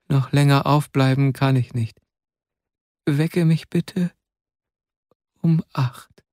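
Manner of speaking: barely able to speak. Acted. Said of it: "Er konnte kaum sprechen".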